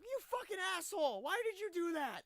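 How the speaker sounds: high, loud indignant screaming; hammed-up screeching outrage; acted outraged yell. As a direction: yell it like a furious man just rescued against his will. Acted outrage at being interrupted.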